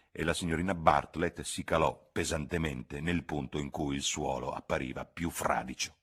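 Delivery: plain reading voice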